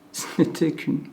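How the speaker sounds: normal talking voice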